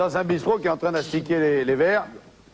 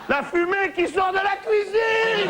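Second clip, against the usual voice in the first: shouted punchline; 13 semitones higher, slower than usual. Acted. Barman shouting the punchline at the fireman. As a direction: yell it out as the big reveal.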